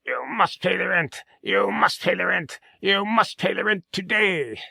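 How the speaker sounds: high, theatrical melodrama villain. Acted. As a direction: menace the damsel over the rent. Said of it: "the villain".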